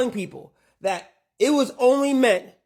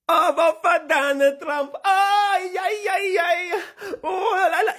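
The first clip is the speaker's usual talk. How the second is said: high-pitched mock celebration, sing-song; mocking cheer; 6 semitones higher, slower than usual. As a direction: crow it like a gullible fan. Mocks cheering Trump voters.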